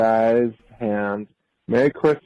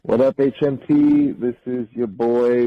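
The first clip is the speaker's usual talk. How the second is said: ordinary talk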